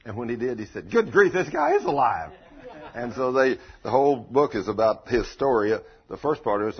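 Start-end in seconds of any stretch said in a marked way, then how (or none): 0.9-2.3 high, loud mock astonishment; astonished paramedic. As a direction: blurt it out as the amazed paramedic. Retold paramedic finds man alive.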